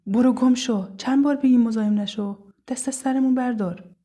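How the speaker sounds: high, loud, furious telling-off; angry outburst. A narrator's reading. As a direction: lash out at a harasser. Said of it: "سارا با عصبانیت غرید".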